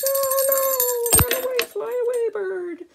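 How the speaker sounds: high, loud, fast warning; jingle bell voice. Acted. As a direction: ring out a frantic warning. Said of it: "the kitty's jingle bell goes".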